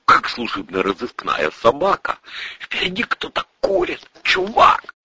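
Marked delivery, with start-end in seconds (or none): 2.6-4.9 very high, excited buddy voice; high-pitched dude voice; slangy young sidekick. Acted. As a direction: squeak it like a jittery pal.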